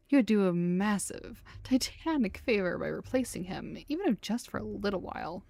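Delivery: ordinary talk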